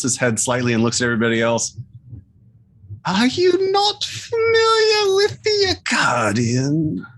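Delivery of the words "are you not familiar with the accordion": high, wailing creature voice. Acted. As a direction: plead in a high monster voice. Creature asks party, high pitch.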